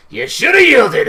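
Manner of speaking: gloating. Acted. Victor gloats over choked man.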